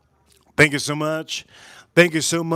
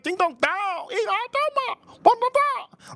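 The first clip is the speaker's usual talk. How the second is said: very high singsong gibberish; mock gibberish. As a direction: squeak nonsense syllables way up high. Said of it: "the gibberish that they".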